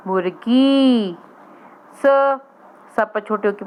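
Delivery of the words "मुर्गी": मुर्गी stretched very slowly, each syllable long; slow sounding out. Shown as a demonstration. Teacher to young pupils, saying मुर्गी slowly. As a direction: draw out मुर्गी for beginners. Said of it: "teacher sounds out word".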